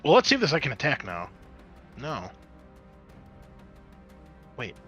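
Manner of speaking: ordinary delivery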